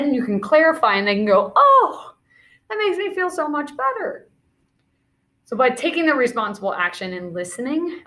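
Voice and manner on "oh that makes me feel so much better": acted relief. Friend relieved after the misunderstanding is cleared. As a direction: sound hugely relieved.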